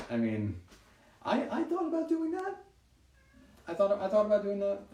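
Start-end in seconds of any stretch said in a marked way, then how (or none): 1.2-4.9 high joking voice. Real, not acted. Joking about singing.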